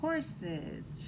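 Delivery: usual speaking voice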